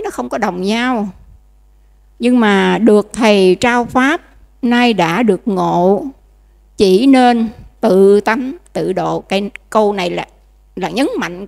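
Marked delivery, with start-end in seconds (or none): 2.2-9.2 slow, louder, measured recitation; slow ceremonial reading of a holy text; solemn scripture reading. Acted. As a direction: read the sutra line with weight. Reads the sutra verse.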